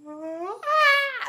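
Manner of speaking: put-on a sudden high wail like a small child about to cry; a child's wail right before the tears; imitated child's wail. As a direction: mimic a kid's rising cry, loud and high. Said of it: "just before, like, a child cries, it's like".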